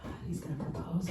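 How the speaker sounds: hushed, breathless whisper; excited whisper. Retold or imitated. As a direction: whisper it like a secret hope. Whispered inner thought of excitement.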